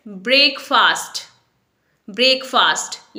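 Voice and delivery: ब्रेकफास्ट as BRAYK-faast; common mispronunciation. Shown as a demonstration. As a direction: say ब्रेकफास्ट like "break" plus "fast". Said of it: "लेकिन इसे ब्रेकफास्ट नहीं बोलेंगे".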